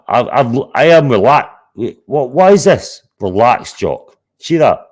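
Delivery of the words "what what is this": ordinary delivery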